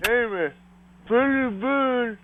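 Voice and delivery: high sing-song to a parrot; high bird talk. Shown as a demonstration. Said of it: "Go up and talk to Red".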